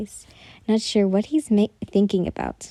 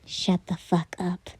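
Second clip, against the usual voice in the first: slower, quieter, menacing repeat; repeated threat; 3 semitones lower, 6 dB quieter than usual. Acted. Repeating it slowly and quietly to the teasing rival. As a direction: spell each word out low as a warning. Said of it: "I said".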